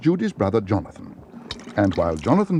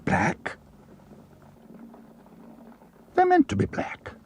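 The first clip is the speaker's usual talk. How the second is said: offended bear, pitched far higher; indignant retort; slower than usual. A narrator's reading. Bear told his ears look dirty, indignant retort. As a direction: bristle at the insult to your ears. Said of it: "said Paddington hotly".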